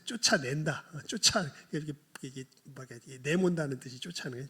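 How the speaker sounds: usual speaking voice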